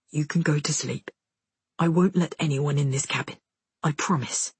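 low, gentle man's voice; reassuring. A narrator's reading. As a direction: soothe a child to sleep. Soldier soothes boy to sleep.

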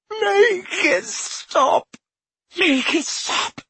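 very high slow moan; moaning plea. A narrator's reading. Dumbledore begging Harry, high slow moan. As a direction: drag it out in anguish. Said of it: "moaned Dumbledore".

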